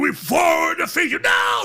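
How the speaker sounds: slow, high-pitched imitation battle cry; screamed incantation. Storyteller imitates the ceremony's voice defying its foe, long high scream. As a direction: scream it out, stretching every word.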